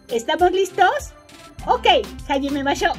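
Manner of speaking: pregunta aguda y entusiasta; animada. Acted. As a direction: anima a la clase con energía. Anima a los alumnos. (tono alegre de maestra animada)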